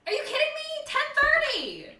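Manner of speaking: high, loud incredulous protest; very high-pitched, loud objection; outraged disbelief. Real, not acted. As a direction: squawk it, stretching out the time. Player protests the game ending early.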